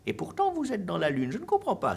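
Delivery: high, loud exasperated teacher voice; Monsieur Valu, vexed. A narrator's reading. Teacher to his most attentive-looking pupil, vexed high-pitched outburst. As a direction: scold at full voice, pitch leaping way up. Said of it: "la voix vexée de Monsieur Valu".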